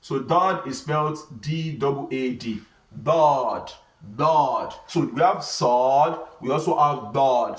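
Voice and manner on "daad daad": "Dod" heavy and emphatic, from the throat; heavy letter. Shown as a demonstration. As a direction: say "Dod" as a heavy Arabic letter, long vowel. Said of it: "So these are heavy letters".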